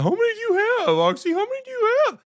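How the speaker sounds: squeaky high mocking taunt. Taunting a nervous friend, high squeaky mock voice. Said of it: "I'm talking mad".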